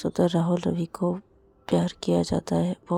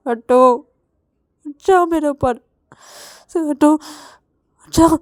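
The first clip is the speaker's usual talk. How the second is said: loud, higher angry shouts to get off; angry and tearful; 7 semitones higher, 9 dB louder than usual. Acted. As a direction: push him away with a tearful yell. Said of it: "Ravi गुस्से में आके ... रोते-रोते". (गुस्से में रोते हुए)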